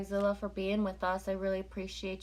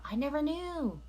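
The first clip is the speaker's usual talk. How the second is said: higher, wide-eyed disbelief; genuine surprise; 8 semitones higher than usual. Real, not acted. Streamer just learning the band's origin, surprised. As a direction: let it catch you off guard.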